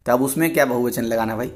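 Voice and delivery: normal talking voice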